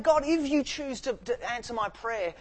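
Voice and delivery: imitating an anguished cry to God; pleading prayer. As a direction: beg God like Hannah in her bitterness. Hannah's prayer acted out.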